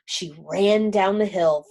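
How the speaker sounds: usual speaking voice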